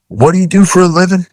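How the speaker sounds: high, fast, pointed question; mock challenge. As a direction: imitate a captain challenging a nitpicker. Captain challenges nitpicking client.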